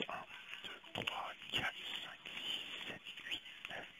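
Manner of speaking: counting the cards in a whisper; murmured count while dealing cards. Real, not acted. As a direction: whisper the numbers under your breath. Counting cards under breath.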